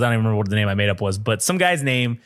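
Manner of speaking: ordinary talk, nothing marked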